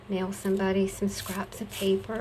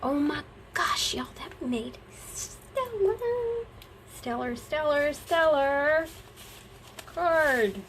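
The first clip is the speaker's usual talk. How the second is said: higher, delighted; excited; 6 semitones higher than usual. Real, not acted. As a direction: gush over the card. Excited about her card.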